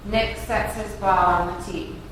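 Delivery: ordinary talk, nothing marked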